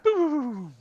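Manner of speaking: high, soft falling "boo"; mouth-made high blaster sound; trademark stun noise. Acted. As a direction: imitate a sci-fi stun blast. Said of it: "you hear the trademark noise of".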